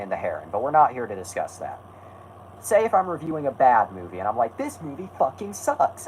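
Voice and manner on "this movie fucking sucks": high-pitched, put-on angry complaint; mock pissy rant. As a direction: act all pissy about a bad movie, without shouting. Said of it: "I'm getting all angry and pissy".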